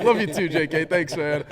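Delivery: loud, high, beaming answer to a teammate; excited loud reply. Retold or imitated. Kicker answering his holder's love you, loudly thrilled. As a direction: shout it back happily to your holder. Loud happy exchange before kick.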